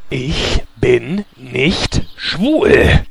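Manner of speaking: langsam gedehntes Leugnen. Acted.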